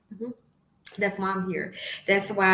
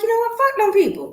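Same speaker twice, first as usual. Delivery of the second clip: much higher and louder, fed-up declaration; defiant outburst; 14 semitones higher, 8 dB louder, faster than usual. Retold or imitated. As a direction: throw it off like you're done caring. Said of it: "and I was like".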